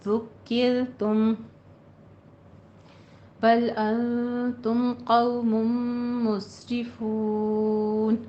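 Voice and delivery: slow chanted tajweed recitation; Quranic recitation. Shown as a demonstration. Teacher recites the full verse for the class, chanted. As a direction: recite it melodically, drawing out the madd. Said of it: "teacher recites verse".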